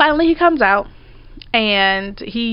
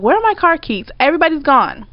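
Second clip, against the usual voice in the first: alarmed; 6 semitones higher than usual. Retold or imitated. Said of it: "I'm like".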